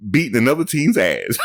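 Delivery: high, amused mock coach voice; laughing mock quote; imitating a coach. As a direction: mimic the coach's line while cracking up. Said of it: "He said".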